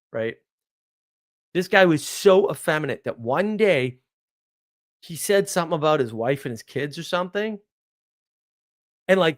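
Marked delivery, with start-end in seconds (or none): none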